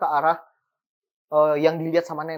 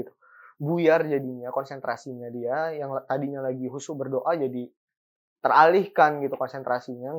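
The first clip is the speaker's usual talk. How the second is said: usual speaking voice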